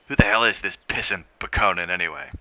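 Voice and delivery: loud, rough American bluster; gruff demand. Acted. Tourist snapping at the cab driver, loud and gruff. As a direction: bark it like an irritated tourist.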